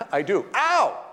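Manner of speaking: a half-laughing retold yelp of pain; mock labor cry. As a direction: snap from vow to a pained "Ow!". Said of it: "little infinitesimal pause between the words".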